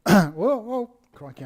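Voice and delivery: sudden loud yelp of alarm, then a breathless gasp; startled whoa. Real, not acted. Artist nearly falling off his perch, startled. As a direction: caught off balance, blurting out.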